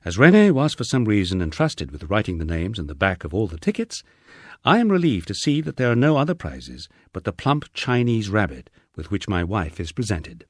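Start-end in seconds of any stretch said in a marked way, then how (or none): none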